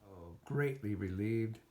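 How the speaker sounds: usual speaking voice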